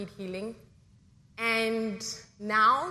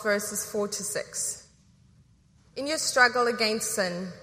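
measured, louder formal reading of Hebrews; scripture reading; 4 dB louder than usual. Acted. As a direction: proclaim the text to the congregation. Reads scripture in service.

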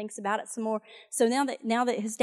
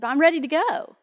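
eager pledge; 5 semitones higher, 6 dB louder than usual. Retold or imitated. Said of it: "That's how it says it in the message".

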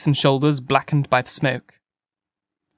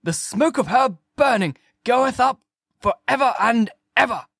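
demented shout; 9 semitones higher than usual. A narrator's reading. Curate cries doom over Weybridge, demented high rant. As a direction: declaim scripture like one demented. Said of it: "he shouted".

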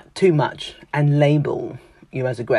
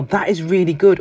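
enthusiastic; 5 semitones higher, 4 dB louder than usual. Retold or imitated. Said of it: "I am that enthusiastic person".

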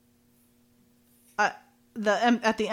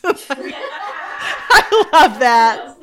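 high-spirited words through laughter; real delighted laughter; 4 semitones higher, 6 dB louder, much slower than usual. Real, not acted. Host loving her reply, genuinely laughing. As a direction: laugh hard and draw out the delight.

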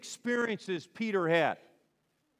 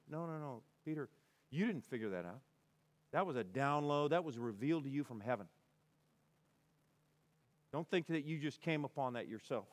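low, hushed gentle putdown; quiet correction; 3 semitones lower, 7 dB quieter than usual. Acted. Jesus corrects Peter about his insight, softly and low.

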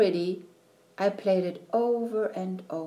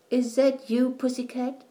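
lightly sleepy, higher-pitched murmur; 5 semitones higher than usual. A narrator's reading. Grandma wakes to her grandchild, sleepy voice. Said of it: "she answered in a sleepy voice".

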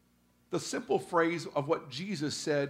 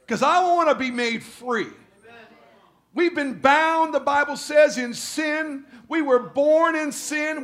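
much higher and louder, slow and ringing; preaching at full force; 8 semitones higher, 7 dB louder, slower than usual. Acted. Pastor rising into full preaching to the congregation. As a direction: build the fire, preacher style.

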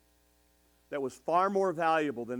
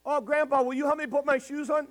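mock little girl; 13 semitones higher, 6 dB louder than usual. Voicing granddaughter.

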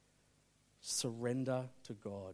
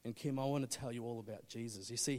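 ordinary talk

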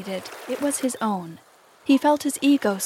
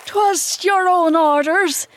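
high, slow, loud faltering protest; 8 semitones higher, 6 dB louder, slower than usual. A narrator's reading. Boatswain excuses himself to Hook, high and faltering. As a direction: protest falteringly to the captain, pitched up. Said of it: "the boatswain faltered".

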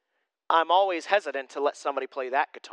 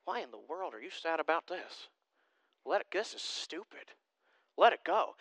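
a muttered, much softer inner voice; mock private grumble, much softer; inner exasperation; 2 semitones lower, 9 dB quieter than usual. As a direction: think it under your breath, annoyed. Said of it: "my brain immediately goes to".

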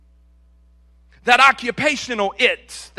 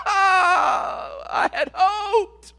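hammed-up sob of despair; mock wailing; 10 semitones higher, much slower than usual. Preacher imitating someone whose money ran out, hammed-up wail. Mocks despair over empty bank account.

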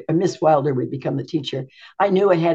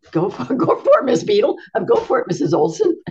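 teasing laugh; 5 semitones higher than usual. Retold or imitated. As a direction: cheer her on through a laugh. Teasing friend about her prayer.

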